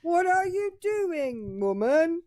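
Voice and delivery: high, slow mock outrage; demanding husband. Husband scolding his wife over dinner, mock outrage. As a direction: draw it out, high and pompous. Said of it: "He's saying".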